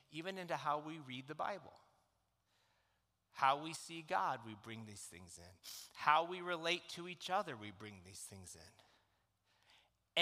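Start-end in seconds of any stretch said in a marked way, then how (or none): 4.5-5.6 soft refrain. Acted. Repeated sermon refrain. 7.6-10.2 low, very slow and soft; slow soft emphasis. Acted. Repeated, slowed refrain.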